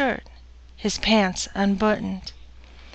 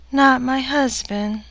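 usual speaking voice

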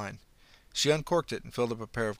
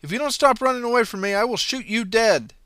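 shouted gun threat; bluffing shout; 11 semitones higher, 11 dB louder than usual. Acted. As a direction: bark a bluff to scare her. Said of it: "Allison tried a bluff".

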